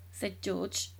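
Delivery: plain reading voice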